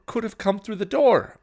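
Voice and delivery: loud, high, emphatic reprimand; heated telling-off; scolding. A narrator's reading. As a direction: scold a reckless younger sister. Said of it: "Buffy scolded".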